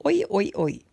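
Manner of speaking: a light Australian chant of oi, oi, oi. Shown as a demonstration. Greeting Australian viewers with a light, playful Australian oi oi oi. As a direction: toss off a playful Aussie oi, oi, oi. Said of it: "Now I sound like I'm Australian".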